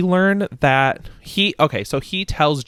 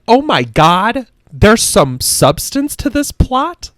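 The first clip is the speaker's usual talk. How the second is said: surprised; 8 semitones higher, 5 dB louder, slower than usual. Retold or imitated. Retelling own shock reading.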